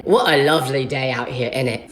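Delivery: put-on low-pitched British accent. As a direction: greet like a cheery Brit, in British accent. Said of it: "didn't expect them to have an accent".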